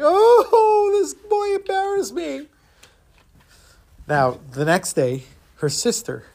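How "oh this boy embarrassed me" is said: high, hammed-up wailing sob; mock bawling in a very high voice; crying. Little girl runs home to complain, crying wail. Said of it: "crying and saying".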